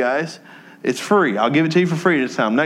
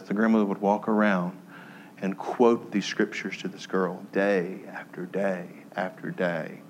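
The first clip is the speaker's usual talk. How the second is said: choked up; 7 semitones lower, 8 dB quieter than usual. Real, not acted. Pastor moved while telling the church about the grandmother, softly choked. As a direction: voice sinking low and soft with emotion. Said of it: "I get emotional every time".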